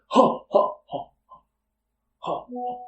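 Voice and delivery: voiceless, breath-heavy "ha" bursts; imitated huffing ha-ha. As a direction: puff out separate mock ha-has. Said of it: "this guy walks up like".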